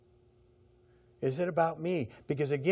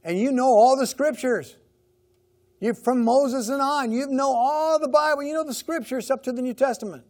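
higher and louder, scolding the religious leaders; Jesus rebuking leaders; 7 semitones higher, 6 dB louder than usual. Retold or imitated. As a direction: raise your voice and scold, as Jesus would. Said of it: "he would say".

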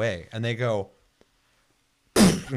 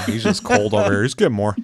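mock accusing; 3 semitones higher, 5 dB louder than usual. Real, not acted. Teasing friend as heartless.

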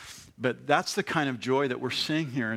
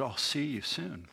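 dying words; 6 dB quieter than usual. Retold or imitated. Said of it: "I just remember the last word".

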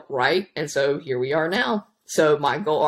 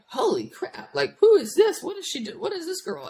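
mock amazed coach; 13 semitones higher than usual. Coach amazed at her deadlift.

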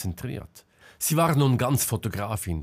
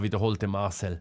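ordinary delivery

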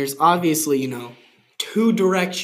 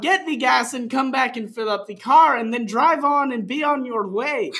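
high, loud proclamation; hammed-up; 10 semitones higher, 4 dB louder than usual. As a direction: boom it out grandly. Hammed-up reading.

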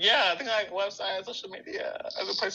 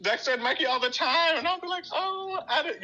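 excited high voice; 11 semitones higher than usual. Real, not acted.

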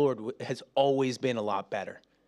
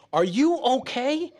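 concerned ask; 9 semitones higher, 3 dB louder, slower than usual. Pretend. Family member asking gently.

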